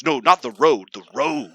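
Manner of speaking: loud start, emphatic correction; exasperated dad impression. Dad correcting son about the road, mock exasperation. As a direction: imitate a father annoyed at being misunderstood.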